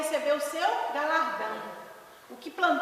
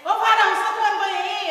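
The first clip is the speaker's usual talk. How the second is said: loud, high mock holler; zealous roommate calling through to the shower; chamando aos gritos; 6 semitones higher, 12 dB louder, faster than usual. Roommate calls to him in shower. (chamando alto, imitando colega crente empolgado)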